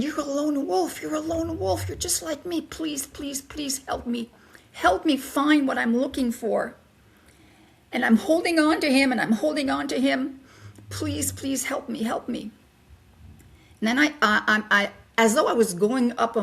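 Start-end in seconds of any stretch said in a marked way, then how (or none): 0.0-6.7 pleading. Retold or imitated. Begging the guide for help. 10.9-12.5 pleading. Retold or imitated. Begging while holding on to him.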